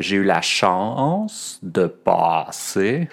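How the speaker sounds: "chance" and "passer" slowly, with diphthonged vowels; exaggerated Montréal vowels. Shown as a demonstration. Teacher demonstrating the Montréal diphthong to students. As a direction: drag out each vowel the Montréal way. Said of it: "J'exagère un peu".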